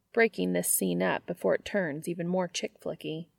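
usual speaking voice

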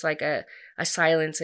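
ordinary delivery

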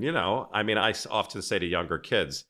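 ordinary delivery

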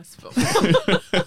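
loud, very slow laughing denial; mock-deny it while cracking up; retold protest dissolving into laughter. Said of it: "J'ai dit comme".

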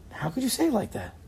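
normal talking voice

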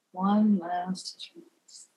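very slow, low, resigned. A narrator's reading. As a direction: give in wearily. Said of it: "in a resigned voice".

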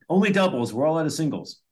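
high, rapid-fire order-caller imitation; SNL diner cook. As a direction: call out the order like a sketch-comedy cook. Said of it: "He goes, um".

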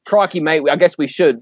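put-on weak, joking Australian accent barely shifted from own voice; half-hearted Aussie mimicry of a teammate; a mild mock Australian accent, teasing; bad Australian accent. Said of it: "Worst Australian accent ever".

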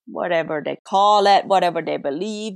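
normal talking voice

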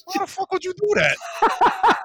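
high, laughing disbelief; stunned, laughing; shocked laughing. Real, not acted. Shocked by slap story.

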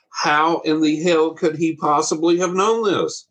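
can't believe what she's hearing; stunned disbelief. Retold or imitated. Shocked wife pressing the parents about the vision, stunned and slow.

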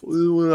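high drawn-out "ooh" of chatter; high-pitched heckling hoot; mock jeering "ooh". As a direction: imitate a platoon's teasing ooh. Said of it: "I got some chatter from".

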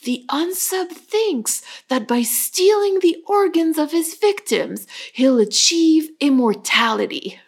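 David Rossi. Acted. Podcaster quoting a crime show profiler, hammed-up solemn pronouncement. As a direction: announce the killer's theory with put-on dramatic weight. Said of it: "David Rossi going like".

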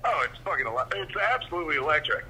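normal talking voice

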